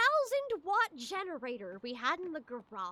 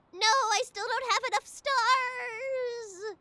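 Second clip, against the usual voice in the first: mock despair; 9 semitones higher, 6 dB louder, slower than usual.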